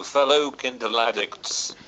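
ordinary talk, nothing marked

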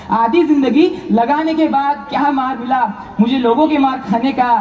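ordinary delivery

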